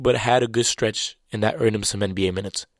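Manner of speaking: ordinary talk, nothing marked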